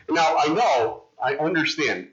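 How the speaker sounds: ordinary talk, nothing marked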